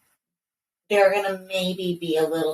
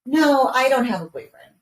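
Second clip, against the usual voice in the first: put-on child's protest; 7 semitones higher, 4 dB louder than usual. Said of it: "And she's like".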